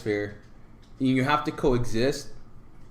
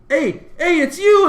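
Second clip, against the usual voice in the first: high excited shout of recognition; surprised recognition; 8 dB louder, slower than usual. Retold or imitated. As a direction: point and yell in sudden delight. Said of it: "he goes".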